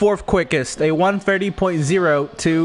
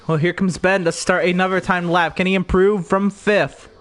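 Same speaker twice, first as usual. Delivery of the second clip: race commentary. Acted.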